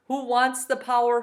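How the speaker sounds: plain reading voice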